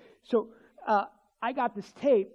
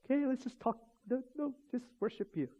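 much quieter retold talk with God; soft, intimate prayer; 3 semitones higher, 8 dB quieter than usual. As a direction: speak privately to the Lord, hushed. Acts out private talk with God.

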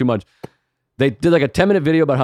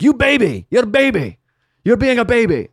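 scolding nurse; 9 semitones higher than usual. Retold or imitated. Eastern European nurse mocking the patient as a baby, high scolding voice. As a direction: scold him like a whiny child. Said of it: "some kind of Russian or Eastern European".

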